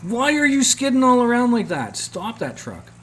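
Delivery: high, mock-scolding barmaid; tavern lady scolding. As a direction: play the fussy tavern keeper, voice jumping up. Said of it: "the tavern lady's like".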